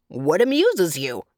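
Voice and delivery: a hint of a touchy clown; demanding clown. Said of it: "demanded the clown".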